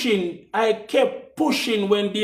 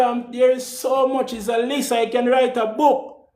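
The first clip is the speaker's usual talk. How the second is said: normal talking voice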